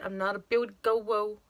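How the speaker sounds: normal talking voice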